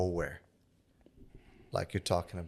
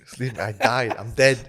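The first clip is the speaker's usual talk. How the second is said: mock tragic, louder each line; dramatic dying plea; 3 semitones higher, 9 dB louder than usual. Friend mocking his crash drama, hammed-up dying plea. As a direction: ham up a dying hero's last words. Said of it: "That's so dramatic".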